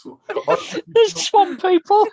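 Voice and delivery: high, slow squeal through laughter; laughing echo. Real, not acted. Echoing a friend's line, laughing high and slow. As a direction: squeal it out while cracking up.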